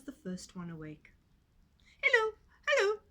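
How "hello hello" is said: high, loud piglet call; calls out to wake the farm. A narrator's reading. Little Pig calling the sleeping animals, high and loud. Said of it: "he called".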